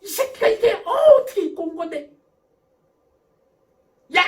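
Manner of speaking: high, loud acted outburst; furious parent. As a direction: yell at the child who squirted ketchup. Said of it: "대부분의 부모들은". (버럭 화내며 높고 크게 야단치듯)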